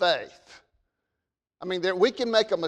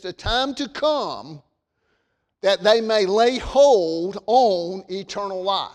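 scripture reading. Acted. Pastor reading Timothy to his congregation, solemn and measured. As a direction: proclaim the verses to the pews.

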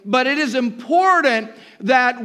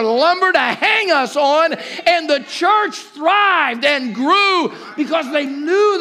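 full-force preaching; 4 semitones higher than usual. Acted. Preacher rallying the church about persecution, shouting. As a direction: preach it hard, rising to a shout.

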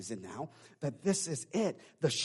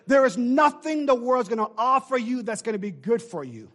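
ordinary talk

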